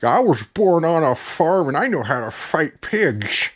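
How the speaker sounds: slow, slurred mock farm-girl voice; Holly Hunter impression. Reviewer ridicules the actress with a made-up farm line, slurred mimicry. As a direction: slur every word in a mocking put-on voice. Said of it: "Talking like this?".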